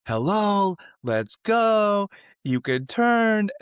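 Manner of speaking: imitated driver's holler, high and loud; mock yell with drawn-out words; impatient yelling. Truck driver to girl stalled ahead at the light, impatient mock yell.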